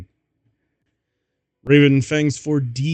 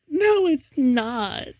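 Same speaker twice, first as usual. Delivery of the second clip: much higher, slow hammed-up yell; mock angry shout; slower than usual. Ebony snaps at Vampire, a hammed-up high shout. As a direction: overplay the outburst. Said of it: "I shouted angrily".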